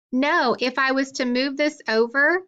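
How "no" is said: ordinary talk, nothing marked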